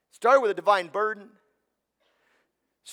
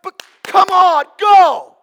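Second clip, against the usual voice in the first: big booming cheer, high and loud; 9 semitones higher, 13 dB louder than usual. Pretend. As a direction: shout encouragement like a proud dad. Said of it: "I think God's up there going".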